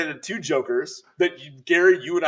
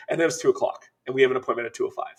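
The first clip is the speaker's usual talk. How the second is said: ordinary talk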